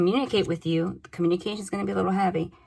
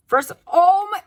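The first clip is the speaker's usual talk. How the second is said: overwhelmed; 11 semitones higher, 7 dB louder, slower than usual. Real, not acted. Reader overwhelmed by the cards.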